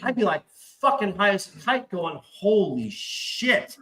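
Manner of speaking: high, giddy, thrilled to be lawless; gleeful survivalist fantasy. Retold or imitated. Telling his friend how he'd love the apocalypse, giddy and high. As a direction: pitch it up like the apocalypse is a party. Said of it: "I'm like".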